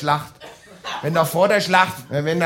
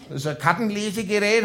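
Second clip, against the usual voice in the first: ordinary delivery, nothing marked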